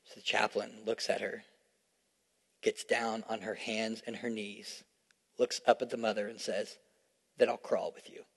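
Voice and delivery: low, soft storytelling voice; softly telling a sad story to the congregation; hushed sermon story. Acted. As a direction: draw them in with a quiet, tender retelling. Long story told softly.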